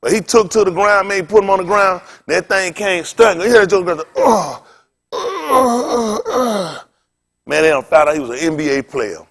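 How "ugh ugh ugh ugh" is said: drawn-out mock groans of pain; tasered groaning. Acting out the man stunned on the ground, pained groans. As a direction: imitate someone groaning under a taser.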